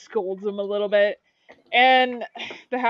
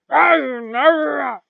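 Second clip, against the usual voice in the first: loud, higher gruff orc barks; orc chief grunt; 5 semitones higher, 9 dB louder, much slower than usual. Acted. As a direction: bark it like a grumpy orc chief. Orc war chief grunts at them.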